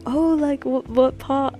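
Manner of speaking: lightly imitating Irish men, high; quoting the guys with a lifted voice; high-pitched Irish guys. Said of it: "not even gonna try to do an Irish accent".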